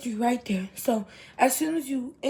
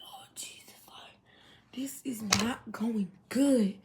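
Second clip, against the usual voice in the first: softer, slower unvoiced whisper; scared whisper; 5 dB quieter than usual. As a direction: whisper it like you're afraid to be heard in the dark. Scared riding home in dark.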